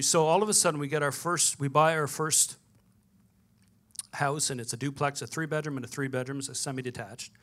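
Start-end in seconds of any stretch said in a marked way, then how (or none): none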